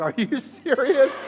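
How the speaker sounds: high, loud comic disbelief; drawn-out, squeaky "you can't mean it" outburst; incredulous. Retold or imitated. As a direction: blurt it out in outraged disbelief. Woken sleeper acts incredulous at seatmate.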